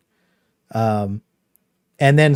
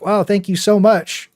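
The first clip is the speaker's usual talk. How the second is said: mock grateful gush; 7 semitones higher than usual. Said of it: "he was like".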